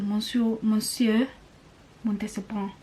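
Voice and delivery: hesitant French accent, piece by piece; amateur French accent with pauses; attempted French accent. Shown as a demonstration. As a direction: practise the name in a tentative French accent. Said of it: "if my French accent was".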